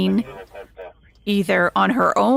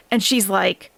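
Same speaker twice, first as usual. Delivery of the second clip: usual speaking voice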